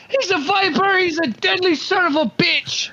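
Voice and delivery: high, hearty yell; acted yell. Player repeating his character's outburst, acted yell. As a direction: yell it to rouse the room. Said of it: "I say, I yell".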